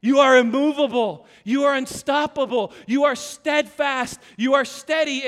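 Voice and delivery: loud, emphatic preaching; preaching at full force. Acted. Pastor proclaiming to the church, loud and emphatic. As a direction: drive each "you are" home to the congregation.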